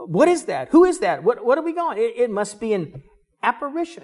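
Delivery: startled questions, high and stumbling; acted fright. Disciples spot a figure on the sea, acted fright. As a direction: play the alarm, pitch jumping up. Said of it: "and were frightened".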